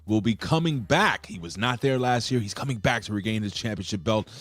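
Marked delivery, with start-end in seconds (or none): 0.1-1.3 loud, higher ring-announcer call; announcer hype. Acted. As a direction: sell the name to the crowd. Announcing champion return.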